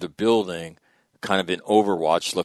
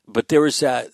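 normal talking voice

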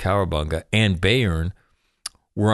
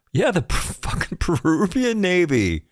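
high, laughing yeah at the Peruvian Navy; laughing disbelief; 10 semitones higher than usual. Real, not acted. Host laughing at the Peruvian Navy showing up, pitched high.

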